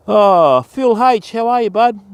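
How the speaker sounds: light up and call out a big welcome; excited greeting. Real, not acted.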